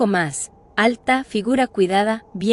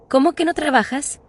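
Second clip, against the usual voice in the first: incredulous question; 7 semitones higher than usual. Acted. Narrator questions how she pays.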